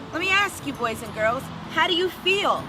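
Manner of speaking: ordinary delivery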